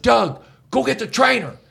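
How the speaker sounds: high urgent call across the gym; retold yell. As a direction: shout for the trainer, pitched high. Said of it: "And I yell".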